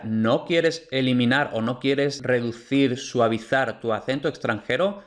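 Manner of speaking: ordinary talk, nothing marked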